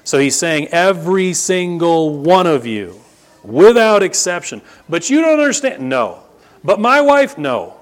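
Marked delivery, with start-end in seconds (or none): 0.7-4.6 high, loud stress on "every single one" and "without exception"; emphatic. Retold or imitated. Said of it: "he's throwing emphasis on this". 4.9-5.9 high-pitched, loud mock protest; imitated husband making excuses, pitched way up; protesting objector. Voices an objecting husband, answered no. 6.6-7.4 high, loud mock excuse; imitated husband protesting, pitched way up; protesting objector. Voices an objecting husband, cut off.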